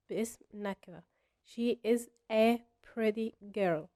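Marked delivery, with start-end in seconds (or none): 1.4-3.9 whole sentence said very slowly and clearly; slow model sentence. Shown as a demonstration. Teacher giving the answer sentence, slow and deliberate. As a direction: read the answer out word by word. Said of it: "model answer sentence".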